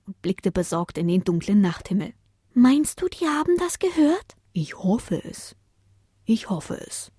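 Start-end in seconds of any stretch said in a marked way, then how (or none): 2.5-4.4 put-on much higher child voice, worried; a worried little pup; small child. 4.5-7.1 softer, slow worried murmur; hope it quietly; softly worried reply. Acted. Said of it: "antwortete Paule besorgt".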